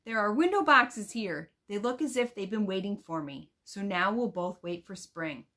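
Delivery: put-on expressive read-aloud of a girl's letter; reading aloud to children. Teacher reading a letter from the book to her students, expressive storybook voice.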